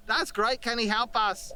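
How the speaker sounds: high attempt at an accent with a lick of British; failed accent, landing on British. Shown as a demonstration. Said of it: "it's all got a lick of British to it".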